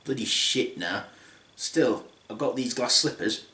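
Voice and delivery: soft, low glum muttering; deflated Cinderella. Acted. Cinderella home alone, muttering softly and low. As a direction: mutter it to yourself, let down. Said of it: "Cinderella then goes home and says".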